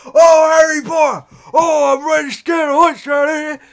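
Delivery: loud, shouted elf impression; hammed-up audiobook elf, high and blaring. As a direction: belt out a mock house-elf voice. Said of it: "he just does his Dobby voice again".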